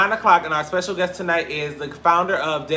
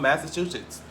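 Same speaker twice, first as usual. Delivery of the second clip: ordinary talk, nothing marked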